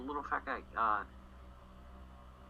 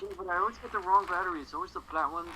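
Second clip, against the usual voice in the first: whiny excuse; 10 semitones higher, 4 dB louder, faster than usual. Retold or imitated. Hawkeye voiced excuse.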